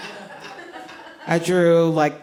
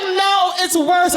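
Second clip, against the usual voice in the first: put-on little girl voice; 10 semitones higher, much slower than usual. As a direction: cry it out like a scared kid. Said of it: "She's like".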